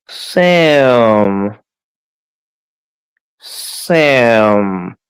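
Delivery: normal talking voice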